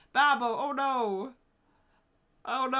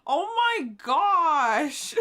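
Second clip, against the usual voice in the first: loud, slow laughing exclamation; laughing cry; 5 semitones higher, 9 dB louder, much slower than usual. Real, not acted. Streamer reading a sad DM, laughing out loud. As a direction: burst out laughing at the message.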